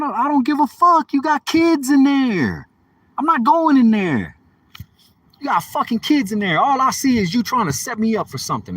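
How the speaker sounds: loud, high-pitched indignant protest; heated refusal. Retold or imitated. Man refusing to go in with her kids there, indignant.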